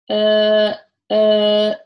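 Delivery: sustained, steady dark L hum; L sound. Shown as a demonstration. Teacher demonstrating L for learners, sustained L sound. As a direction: hold "うー" loudly with tongue tip behind upper front teeth. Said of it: "これがLの音です".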